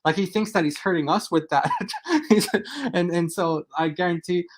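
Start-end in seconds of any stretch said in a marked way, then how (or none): 1.7-2.2 quick laugh, much higher; a short amused laugh, pitched up; high little laugh. Real, not acted.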